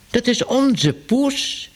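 high, slow cry; vicar calling out. A narrator's reading. Vicar recognises his cat, high and slow. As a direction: let it rise, stretched and dismayed. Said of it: "riep de dominee".

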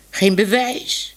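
"Geen bewijs?" slow, rising up high at the end; disbelieving echo. Acted. Minoes repeats Tibbe's words, slow and rising in disbelief. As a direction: echo it back as if it can't be true.

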